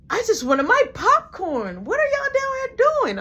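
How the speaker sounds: put-on high squeaky kid asking what's going on; kid brother voice. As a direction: play the whiny little brother. Little brother voiced in story.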